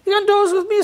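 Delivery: high, sleepy tortoise offer; a drowsy tortoise inviting Duck. A narrator's reading. Tortoise inviting Duck to doze, high-pitched. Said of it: "said Tortoise".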